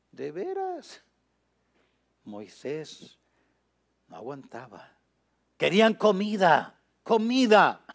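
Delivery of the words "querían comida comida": loud, raised cry of mimicked complaint; mock the clamour, high and loud; demanding shout. Preacher mimics the hungry people clamouring for food, demanding shout.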